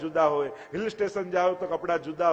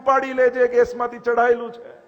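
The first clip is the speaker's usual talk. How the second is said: higher, louder mock yell across the house; imitating a shout; 7 semitones higher, 4 dB louder than usual. Devotee yelling to the kitchen during worship, mock shout. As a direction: call out to the kitchen like a careless devotee. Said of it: "એવી બૂમો પાડે".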